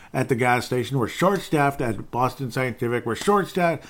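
sarcastic singsong imitation of staff; mocking whine. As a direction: parrot the excuse with contempt. Mocking staff excuses, listed.

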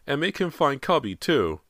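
put-on a small boy demanding with a pout; young son pleading with his father, pouting; pouting little boy. A narrator's reading. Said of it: "James insisted, pouting".

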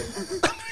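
pterodactyl shriek. Shown as a demonstration. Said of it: "that's a pterodactyl".

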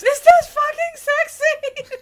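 high, loud screamed question; screaming. Retold or imitated. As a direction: scream it at the screen in disbelief. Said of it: "I screamed at Steve several times".

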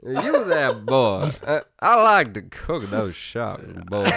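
slow, drawled put-on American accent. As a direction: put on an exaggerated American twang, slowly. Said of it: "the only American accent I can do".

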